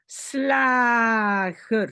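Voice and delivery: "Slager" with a very long a; open-syllable demo. Shown as a demonstration. Teacher shows learners an open syllable, drawn-out demo. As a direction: draw out "Sla", then add "ger". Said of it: "syllabe ouverte".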